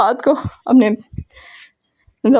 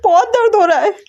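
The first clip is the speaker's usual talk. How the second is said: shouting in pain; 7 semitones higher than usual. A narrator's reading. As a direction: cry out while pulling her hair. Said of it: "ये चिल्लाते हुए".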